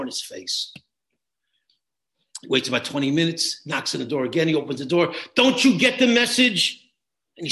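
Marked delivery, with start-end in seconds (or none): 5.4-6.9 louder, higher and slow; furious slow "Don't you get the message?"; angry retort. Retold or imitated. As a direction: snap it at him before slamming the door. Other father slams door on him.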